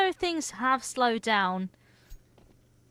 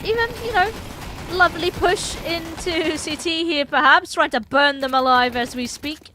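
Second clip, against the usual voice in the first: gleeful, lifted commentary; too happy; 7 semitones higher, 5 dB louder than usual. Real, not acted. Caster describing a push to burn players out, too happy. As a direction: relish the attack a bit too cheerfully. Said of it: "You sounded way too happy".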